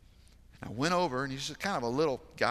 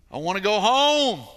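put-on higher, louder anguished child's cry; mock sobbing wail, higher and louder; imitating a sobbing boy; 8 semitones higher, 12 dB louder than usual. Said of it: "with how hard he's crying".

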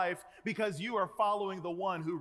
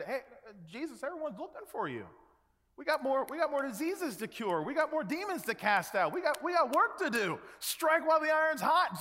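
eager urging; 6 semitones higher than usual. Retold or imitated. Disciples pressing Jesus to keep working, building excitement. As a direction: talk him into it, getting more insistent.

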